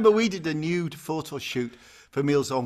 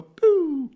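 loud high puff of breath; duck-dive blow; 10 dB louder than usual. Retold or imitated. Showing how a duck dive sounds, loud and high. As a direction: blow it out like surfacing from water. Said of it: "You know, you go".

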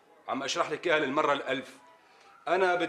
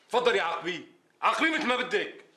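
angry challenge; 6 semitones higher than usual. Acted. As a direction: dare her, building in anger. Father challenges wife angrily.

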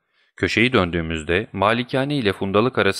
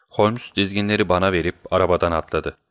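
ordinary delivery, nothing marked

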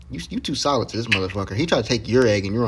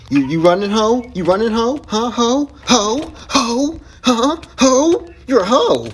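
high, punchy repeated jeers; loud taunting; 8 semitones higher, 5 dB louder than usual. Real, not acted. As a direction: jeer after a fleeing opponent. Taunting a fleeing opponent.

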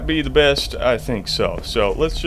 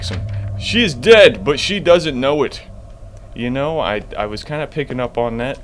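ordinary talk, nothing marked

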